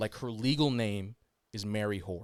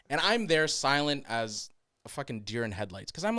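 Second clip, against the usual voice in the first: normal talking voice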